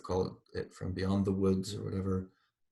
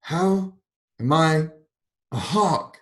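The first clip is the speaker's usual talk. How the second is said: slow, high, louder indignant demand; 7 semitones higher, 7 dB louder, slower than usual. A narrator's reading. Mrs. Turpin demanding that God explain, indignant. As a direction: insist on an answer, stung. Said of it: "she demanded".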